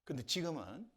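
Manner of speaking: ordinary talk, nothing marked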